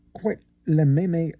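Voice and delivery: put-on slow, French-accented la même chose. Narrator showing off his French, lightly accented. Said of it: "as we say in France".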